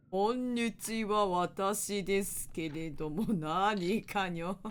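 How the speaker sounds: warped, dragged-out filtered voice; voice changer. Real, not acted. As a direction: talk through a slow-motion voice filter. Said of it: "声変わるやつ？".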